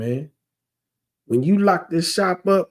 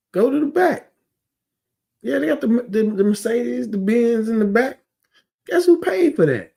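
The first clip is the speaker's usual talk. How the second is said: rising pitch, fired-up indignation; heated rant; 8 semitones higher than usual. Real, not acted. Preacher ranting at shop owners, pitch climbing with indignation. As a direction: get worked up and climb in pitch.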